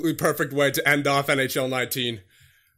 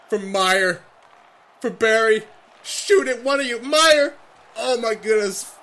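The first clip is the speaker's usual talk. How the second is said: high, loud, climbing play-by-play shout; frantic urging; 8 semitones higher, 5 dB louder than usual. Real, not acted. Player begs teammates to shoot in overtime, frantic shouting. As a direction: escalate to an exasperated yell for a shot.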